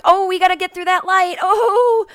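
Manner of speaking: high, loud panicky whine at the driver; frantic backseat urging. Retold or imitated. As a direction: hurry him through the light, squealing. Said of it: "I'm doing that in the back seat".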